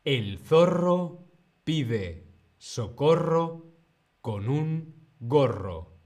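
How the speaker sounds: word-by-word tongue twister, pauses between phrases; slow model reading. Shown as a demonstration. Teacher gives learners time to repeat, slow and broken up. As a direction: break it into slow, separate chunks. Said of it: "luego lo repites tú".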